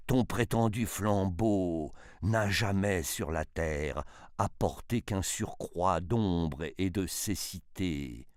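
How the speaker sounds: ordinary delivery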